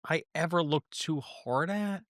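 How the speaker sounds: normal talking voice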